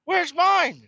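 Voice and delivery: whiny daughter. Retold or imitated. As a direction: whine like a left-out little girl. Jealous daughter demands her own.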